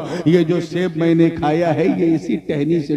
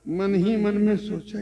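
ordinary delivery

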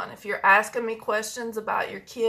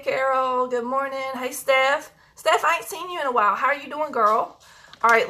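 ordinary talk

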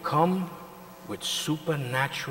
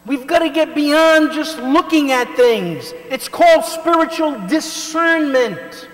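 high, loud revival preaching; fiery preaching; 13 dB louder than usual. Acted. Preacher rousing the congregation, loud high preaching. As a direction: preach it out at full force to the congregation.

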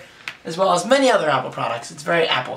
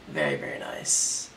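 hushed admiration; 13 semitones lower, 8 dB quieter than usual. Real, not acted. Admiring new phone, hushed.

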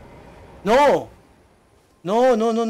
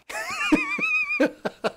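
sudden squeaky laugh, much higher; high burst of laughter; 3 dB quieter than usual. Real, not acted. Reacts to the funny job name. (carcajada aguda y repentina)

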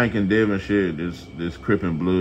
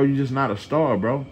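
usual speaking voice

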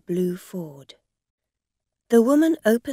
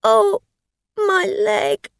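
higher, louder pained wail; crying; 14 semitones higher, 9 dB louder, slower than usual. Acted. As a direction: wail about the leg, faking hurt. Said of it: "She began to cry".

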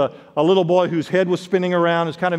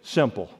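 "simple" set apart, dropped lower and softer; emphasized word; 7 semitones lower, 6 dB quieter than usual. Real, not acted. Preacher singling out one word for the congregation, "simple" low and soft. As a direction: land "simple" quietly on its own after a beat. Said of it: "I want to emphasize one word there".

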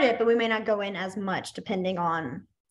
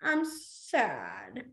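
soft, downcast sad demo; acted sadness; 7 dB quieter than usual. Shown as a demonstration. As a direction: act mildly sad, stretching the word. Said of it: "make it look like you are really".

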